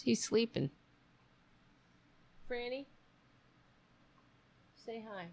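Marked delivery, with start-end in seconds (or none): 2.5-5.3 very soft, higher voice to a pet; soft coaxing. Real, not acted. Streamer coaxing the sleeping cat to say hi, softly. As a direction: gently call a sleeping cat, barely audible.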